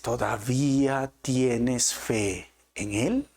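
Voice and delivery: drawn out slowly, each word weighed; slow searching question. Shown as a demonstration. Preacher asking the congregation, slow and searching. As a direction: let the question hang, very slowly.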